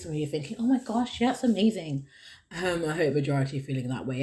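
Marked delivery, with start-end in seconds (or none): 0.6-2.1 higher, louder gush of amazement; mock delighted listener. Host imagining impressed listeners, gushing.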